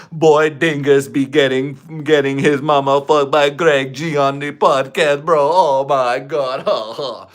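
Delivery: slow, hammed-up Jamaican patois; Jamaican accent. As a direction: put on a drawn-out mock Jamaican accent.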